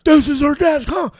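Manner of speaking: high, loud triumphant sneer; hammed-up crazed gloat; manic Cage. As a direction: gloat like a madman. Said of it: "he's like".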